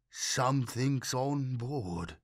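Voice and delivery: slow, blank, toneless; blank monotone. A narrator's reading. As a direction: say it as if in a trance. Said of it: "he said in a blank monotone".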